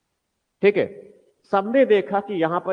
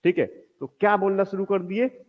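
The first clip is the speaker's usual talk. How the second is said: ordinary talk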